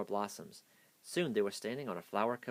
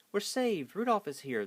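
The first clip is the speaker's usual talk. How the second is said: much higher, louder relieved cheer; joyful cry; 11 semitones higher, 5 dB louder than usual. A narrator's reading. The trapped sprites greeting their rescuer, joyful cry. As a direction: burst out with relief. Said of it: "the sprites cried".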